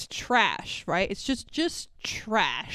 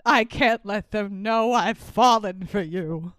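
slow, lowered soap-opera melodrama; funnily bad love confession; 4 semitones lower, 3 dB louder than usual. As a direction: overact a soapy confession, slow and low. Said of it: "just that delivery alone".

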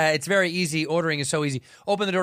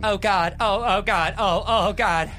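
hammed-up, slightly louder moaning; mock passion; 3 semitones higher, 4 dB louder, slower than usual. [name] being kissed by the leprechaun, mock passion. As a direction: repeat it in mounting mock rapture.

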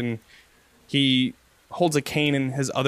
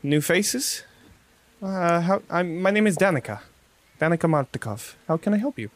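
higher, quick, hospitable innkeeper; innkeeper Danica; 4 semitones higher than usual. Acted. Barkeep welcoming travellers to her inn, higher and brisk. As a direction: welcome new guests briskly from behind the bar. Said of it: "She says".

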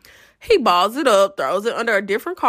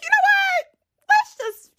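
much higher put-on voice; high mock voice; 3 dB louder than usual. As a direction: voice jumps way up, playful and scheming. Retold thought, deciding to follow.

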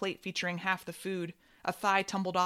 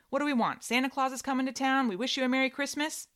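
singsong guessing; 7 semitones higher, 4 dB louder than usual. A narrator's reading. Brother guessing music, singsong.

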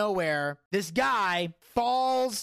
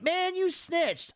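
put-on kid voice; 9 semitones higher, much slower than usual. Kid caught snitching, acted quote.

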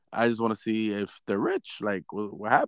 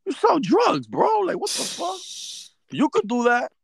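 incredulous outburst. Real, not acted. Angry disbelief.

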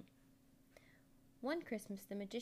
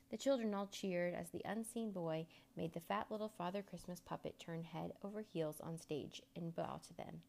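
usual speaking voice

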